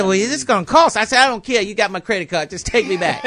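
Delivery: insistent. Retold or imitated. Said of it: "They said".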